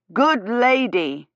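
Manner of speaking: nearly shouting. A narrator's reading. Gawyn addressing a lady who seems not to understand, nearly shouting. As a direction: raise the voice just short of a shout, drawing it out. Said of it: "nearly shouting".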